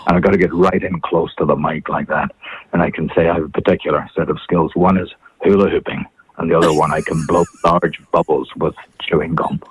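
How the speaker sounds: low, close-to-the-mic action hero; Liam Neeson. Shown as a demonstration. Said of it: "Liam Neeson".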